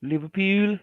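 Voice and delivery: put-on Scouse accent. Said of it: "The Pudlians".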